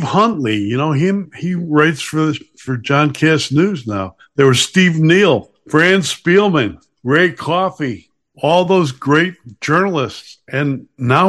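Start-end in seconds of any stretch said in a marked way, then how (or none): none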